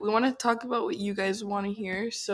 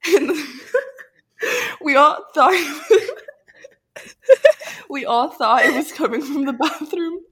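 laughing through the words; can barely talk for laughing; 5 semitones higher, 7 dB louder than usual. Real, not acted. Laughing while retelling scare.

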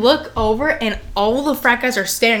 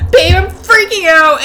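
mock panic; 8 semitones higher, 6 dB louder, slower than usual. Panicked call to boyfriend.

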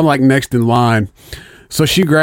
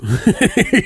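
high laugh; 9 semitones higher than usual. Real, not acted. Laughing at AK-47 joke.

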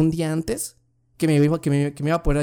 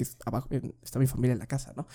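quiet aside; 4 semitones lower, 9 dB quieter, faster than usual. Real, not acted. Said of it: "Lo digo en voz bajita".

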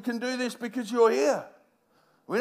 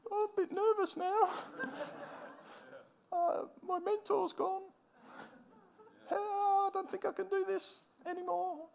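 high, hushed, timid voice; a frightened follower whose mentor is gone; anxious disciple; 8 semitones higher, 11 dB quieter than usual. Retold or imitated. Disciples left without Jesus.

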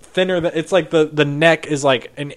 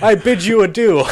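higher, louder, stiff formal greeting; mock alien greeting; 6 semitones higher, 7 dB louder than usual. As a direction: greet a human like a visiting alien. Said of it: "Most of them are like".

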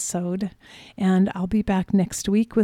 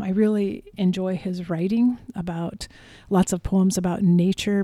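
ordinary delivery

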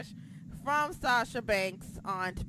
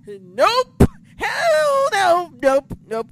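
high, loud hell no; emphatic refusal; 10 semitones higher, 12 dB louder, much slower than usual. Real, not acted. Ranting to listeners about the tag titles, loud shout. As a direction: shout it down, no chance.

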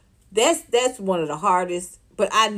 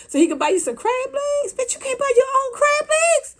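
pitched way up in outraged disbelief; high mocking scolding; incredulous rant. Real, not acted. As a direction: squeal it at her. Ranting at the woman.

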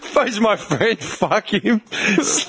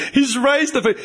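ordinary delivery

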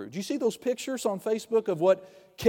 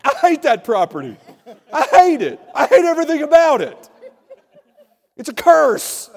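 hammed-up furious shout, much higher and louder, laughing through it; mock temper tantrum; 10 semitones higher, 13 dB louder than usual. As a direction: replay the meltdown as a laughing, over-the-top tantrum. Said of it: "Like a temper tantrum".

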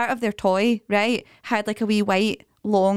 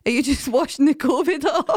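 put-on child voice, laughing; 5 semitones higher than usual. Child's question in shower.

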